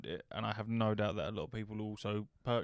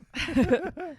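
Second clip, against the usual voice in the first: high laugh; 6 dB louder than usual. Real, not acted. Reacting to the joke.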